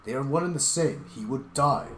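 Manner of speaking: a loud, furious telling-off; angry scolding. Acted. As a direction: tear into him for his foolishness.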